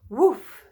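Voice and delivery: short loud imitated bark; one sudden storybook woof; dog woof. A narrator's reading.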